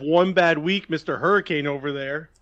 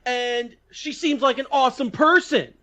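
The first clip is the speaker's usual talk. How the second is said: hammed-up aggressive bro voice, higher and louder; mock bro insult; 8 semitones higher, 6 dB louder, slower than usual. Co-host parodying insult banter with a louder, higher bro voice. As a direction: fake trash talk that turns into compliments. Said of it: "insulting each other the way we do".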